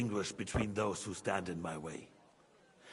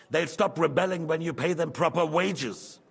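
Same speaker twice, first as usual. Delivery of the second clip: angry; 4 semitones higher, 7 dB louder than usual. Acted. King scolding rebellious miners' envoys.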